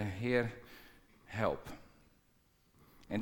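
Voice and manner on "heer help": quiet, falling cry for help; whisper-soft prayer to the Lord; a gentle, humble plea. Acted.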